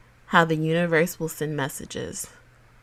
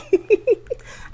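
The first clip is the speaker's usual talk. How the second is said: laughing; 4 dB louder than usual. Real, not acted.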